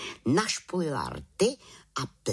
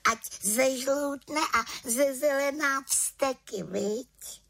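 high-pitched little witch voice; odhodlaná malá čarodějnice; 14 semitones higher than usual. A narrator's reading. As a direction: declare it resolutely in a high little voice. (odhodlaný vysoký hlásek čarodějnice)

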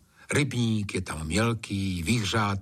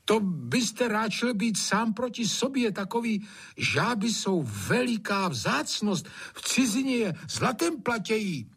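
persuading servant; 12 semitones higher than usual. A narrator's reading. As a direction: coax the master with an earnest tall tale. Servant talks master round.

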